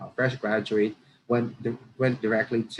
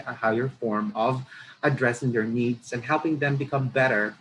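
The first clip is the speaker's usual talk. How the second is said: normal talking voice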